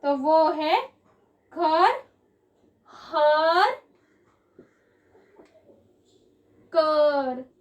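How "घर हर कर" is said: घर, हर, कर each set apart with pauses and stressed; rhyme demo. Shown as a demonstration. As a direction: lean on the ending अर of घर, हर and कर. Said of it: "आप लोग खुद ही pronunciation करके देखो".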